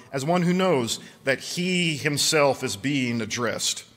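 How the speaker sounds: earnest recitation to the congregation; solemn reading. Acted. Reads Bonhoeffer aloud.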